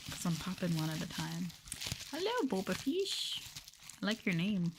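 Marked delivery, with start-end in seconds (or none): 2.1-3.4 cheery greeting. Real, not acted. Greeting a chat viewer.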